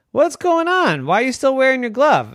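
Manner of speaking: high, loud mom voice; mother asking her boy, puzzled. Acted. Mother questioning son.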